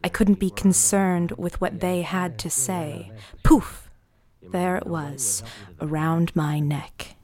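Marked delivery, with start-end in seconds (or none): 3.4-3.9 sudden poof. Acted. Sudden magical appearance in diary.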